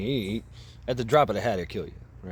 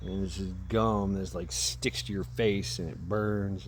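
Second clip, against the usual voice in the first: ordinary talk, nothing marked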